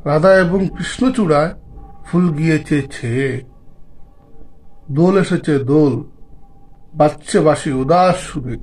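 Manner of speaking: slow, measured verse reading with drawn-out line endings; poem recitation. Acted. Vlogger reading a Holi poem to viewers, poem recitation. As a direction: recite it line by line, pausing after each. Reads a magazine poem aloud.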